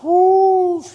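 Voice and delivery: high loud whoo like a gust of wind; loud high wind whoop; whooshing wind cry. Acted. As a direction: blow it out like a howling wind. Imitating the wind.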